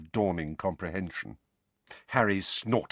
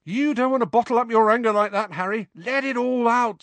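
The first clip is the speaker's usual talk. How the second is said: loud, high, fast mock counselling; teasing encouragement; 5 dB louder than usual. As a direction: egg him on, high and loud. Twin teasing the angry boy.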